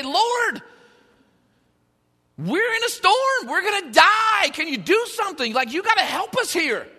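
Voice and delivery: high, loud, frantic plea for rescue; panicked disciples. Acted. Disciples shaking the sleeping Jesus awake, loud and panicked. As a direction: cry out like men sure they're about to drown.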